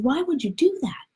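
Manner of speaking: put-on high childlike puzzlement; wondering little soul. Little soul asks the helper why, wondering and high. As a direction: ask it in a small, amazed voice. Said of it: "He says".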